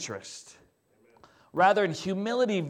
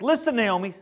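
louder, firm vow; indignant Ruth; 4 semitones higher, 7 dB louder than usual. Retold or imitated. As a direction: declare it with stubborn loyalty. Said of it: "Ruth just gets indignant".

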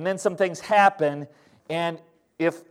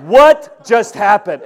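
stunned disbelief; 3 semitones higher, 10 dB louder, slower than usual. Retold or imitated. Drill fails again, disbelief.

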